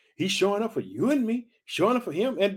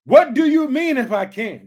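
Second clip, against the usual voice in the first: Jesus rebuking; 6 semitones higher, 9 dB louder than usual. Retold or imitated. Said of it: "Did you hear what Jesus said".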